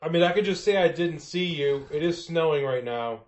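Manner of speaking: high-pitched, reasoning driver voice; snowplow driver. Acted. Plow driver asking resident to move along, high voice. Driver makes veiled threat.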